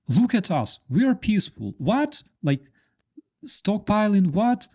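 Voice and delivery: high, wide-eyed mock surprise; playing a fake-friendly hostile neighbour; mock innocent aggressor. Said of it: "the hostile country goes".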